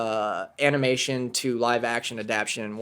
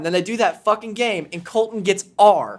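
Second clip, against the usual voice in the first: usual speaking voice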